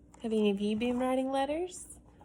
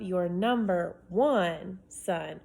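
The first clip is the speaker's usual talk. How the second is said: one drawn out slow; stress on one; much slower than usual. Acted. Boy signing off, number one slowed and stressed. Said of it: "emphasizing a word".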